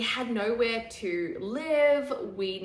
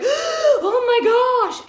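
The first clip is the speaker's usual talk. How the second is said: much higher, louder and slow, drawn out in fright; alarmed cry; 14 dB louder, much slower than usual. Retold or imitated. Frightened passenger crying out to speeding husband. Scared by husband's fast driving.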